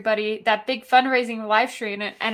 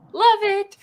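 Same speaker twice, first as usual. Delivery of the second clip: much higher, louder, gushing "love it"; emphatic delight; 9 semitones higher, 4 dB louder than usual. Real, not acted. Host gushing to the whiskey maker, excitedly. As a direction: throw "love it" up high with real delight.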